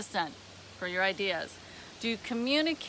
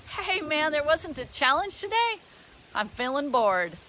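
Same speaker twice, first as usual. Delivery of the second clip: higher-pitched mock eagerness, settling at the end; facetious; 6 semitones higher than usual. Acting someone who embraces challenges enthusiastically, facetious. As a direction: playfully act hungry for a challenge. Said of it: "I'm being a little bit facetious".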